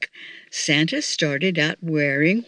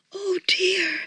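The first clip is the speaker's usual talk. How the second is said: dismayed exclamation; 12 semitones higher than usual. A narrator's reading. Missus Claus finding Santa's boots missing, exclaiming in dismay. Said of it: "exclaimed Missus Claus".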